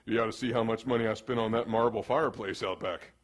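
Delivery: lightly voiced smug homeowner; pride bragging. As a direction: show off the money you spent. Proud partner bragging about spending.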